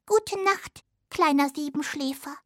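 high little mouse voice; a tiny mouse wishing goodnight, pitched high; little hazel dormouse. Acted. (hohe Stimme einer kleinen Haselmaus)

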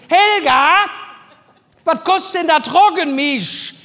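laut nach hinten rufen, höher; gebrüllt nach hinten. Retold or imitated. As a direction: quer durch den Laden brüllen. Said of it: "Beugt sich nach hinten und brüllt". (ruft laut nach hinten zur Kollegin)

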